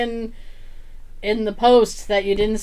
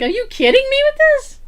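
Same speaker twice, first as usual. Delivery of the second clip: mock disbelief; 14 semitones higher, 4 dB louder than usual. Retold disbelief at fleeing kids.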